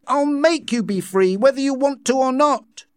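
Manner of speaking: high, loud defiant vow; shouting. A narrator's reading. Savage vowing to free the crowd, shouting. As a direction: declare it forcefully to all. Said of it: "shouted the savage".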